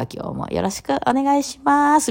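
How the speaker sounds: high, slow, sugary polite greeting; 作った可愛い声. Retold or imitated. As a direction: mimic her old forced-cute slow voice. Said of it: "みたいなゆっくり喋ってた". (昔の自分を真似て、高くゆっくり可愛らしく挨拶する)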